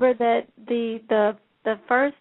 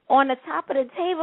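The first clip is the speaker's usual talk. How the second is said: normal talking voice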